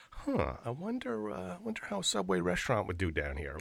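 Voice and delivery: soft, high, wondering aloud; musing dreamer. Retold or imitated. Host voicing the guest's daydreams, hushed musing. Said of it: "you've always had a mentality of".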